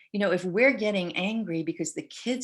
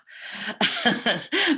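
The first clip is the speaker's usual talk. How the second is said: bright burst of laughter; real laugh; 5 semitones higher, 5 dB louder than usual. Real, not acted. Host reacting to parent of triplets, laughing.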